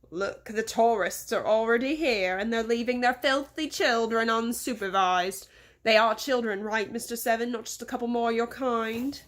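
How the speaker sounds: put-on disdainful English-accented complaint; scornful English boss. Boss complains to servant about unsupervised children, scornful English accent.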